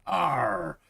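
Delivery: short playful pirate growl; mock pirate arr on putting on the costume. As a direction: give a quick hammed-up pirate arr. Dressed as a pirate.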